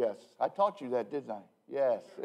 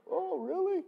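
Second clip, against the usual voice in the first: high squeaky "oh, really?"; a secretary gasps at the pastor's joke; mock surprise; 14 semitones higher, 3 dB quieter than usual. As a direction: play her startled disbelief, pitched way up. Secretary hears "Church of Satan.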